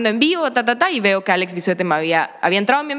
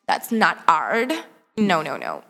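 very slow, drawn-out mock verdict; snobbish refusal; 4 semitones lower, much slower than usual. Actress mimicking her old snobbish self judging Alex, dismissive. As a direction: draw it out solemnly, then sink low on the noes.

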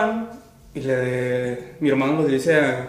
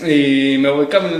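usual speaking voice

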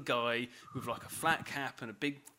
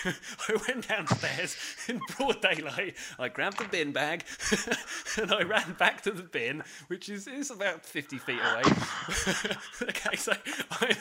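higher, louder, breaking into laughter; laughing; 6 semitones higher, 5 dB louder than usual. Real, not acted. Teller confessing the dash back to the bin, laughing. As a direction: tell it helplessly amused, laughing between phrases. Laughing at own absurd story.

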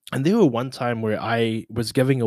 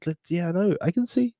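high-pitched mock chill voice; mock reassurance; 7 semitones higher than usual. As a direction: brush off rivals in a high playful voice. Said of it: "It's like".

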